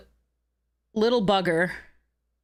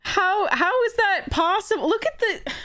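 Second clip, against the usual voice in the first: on the edge of crying over the loss; upset near tears; 5 semitones higher than usual. Real, not acted. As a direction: voice breaking with frustration. Near tears after losing.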